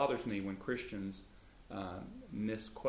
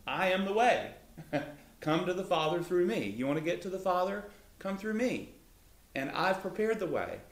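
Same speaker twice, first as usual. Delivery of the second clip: higher and louder, an eager open invitation; inviting Jesus; 8 semitones higher, 4 dB louder than usual. Retold or imitated. Jesus inviting the questioner to the Father, higher, eager and welcoming. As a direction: voice Jesus welcoming everyone in, pitched up. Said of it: "His emphasis was to make it easy".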